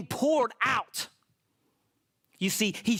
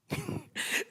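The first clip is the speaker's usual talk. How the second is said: real laugh; 7 semitones higher, 7 dB quieter than usual. Real, not acted. Laughing at son.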